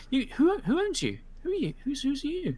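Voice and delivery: put-on a young woman gently asking a strange dog; curious girl. Girl gently quizzing a stray wolf, high and curious.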